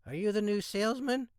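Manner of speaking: high bored receptionist voice; woman's voice. A narrator's reading. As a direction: chew gum, ask without looking up.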